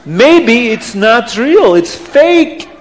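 put-on slow, swooping accented doubt; emphatic, incredulous accusation; suspicious Abdallah. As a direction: draw out the suspicion, rising on the verdict.

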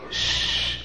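soft unvoiced shh to hush the room; shushing. Real, not acted. Storyteller hushing the children before the book's words, soft shh.

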